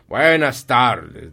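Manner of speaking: loud, very stretched-out goodbye; emphatic dismissal. Acted. Scrooge refuses to hear his nephew, loud slow dismissal. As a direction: drag out each word loudly to cut him off.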